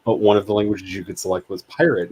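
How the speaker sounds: ordinary talk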